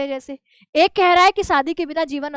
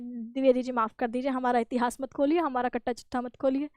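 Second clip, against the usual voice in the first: lower, much softer sheepish plea; 3 semitones lower, 7 dB quieter than usual. Retold or imitated. Shyam Sundar Das begging Dwivedi for mercy, sheepish and softer. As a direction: beg sheepishly, almost under your breath. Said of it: "झेंप जाते हैं".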